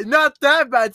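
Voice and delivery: high, loud mocking repeat; mocking echo. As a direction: throw the words back teasingly. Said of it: "so it's bad".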